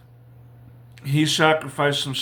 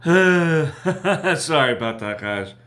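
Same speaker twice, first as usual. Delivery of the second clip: real sheepish laugh into a higher, louder apology; apologetic, with a breaking chuckle; 5 semitones higher, 4 dB louder, slower than usual. Real, not acted. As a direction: laugh off your own slip as you apologize. Laughs off his own name slip.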